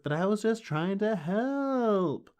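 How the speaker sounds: high, slow, whining excuse; whiny Andrew. Retold or imitated. Andrew answering Buffy's scolding with a high, slow whine. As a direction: plead like a hurt nerdy kid.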